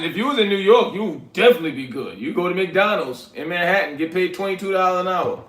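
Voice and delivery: usual speaking voice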